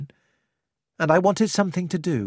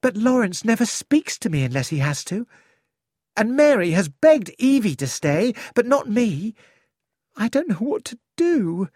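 a young woman pleading for advice, voiced higher; higher, anxious young woman's voice; young Cynthia; 6 semitones higher than usual.

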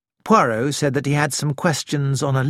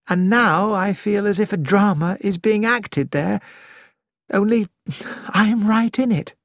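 dreamy voice; 6 semitones higher than usual. Acted. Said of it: "it was in a dreamy voice".

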